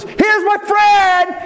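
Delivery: high, slow, sung-out shout of a show-off friend; mock yell. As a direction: bellow it out for everyone to hear. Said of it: "He's yelling".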